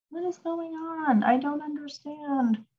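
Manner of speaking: high-pitched puzzled talking to oneself; real bafflement. Real, not acted. Teacher struggling with text that went bigger, high and baffled. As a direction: wonder aloud in a high, confused voice.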